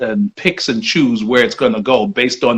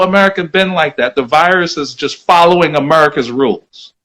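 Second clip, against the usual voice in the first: ordinary talk, nothing marked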